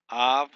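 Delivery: Avraham said loud and clear with a rise; Hebrew word said out. Shown as a demonstration. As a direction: model Avraham for students to repeat. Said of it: "The first one we have is".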